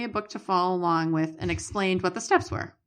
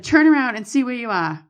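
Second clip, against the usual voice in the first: louder, higher quoted order; raised-voice retold command; acted command; 4 semitones higher, 7 dB louder than usual. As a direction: call out a firm order from a story. Tech's command read aloud after yell.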